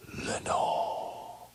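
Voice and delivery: faint whispered echo; echoed murmur. Acted. The dark echoes the name back, a faint whisper. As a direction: let the name come back as a distant echo. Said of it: "an echo murmured back the word".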